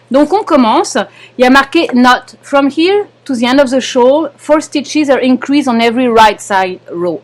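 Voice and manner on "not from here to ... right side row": English read with a French accent; real French-accented English. Real, not acted. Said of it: "vous pourrez rire de mon accent français".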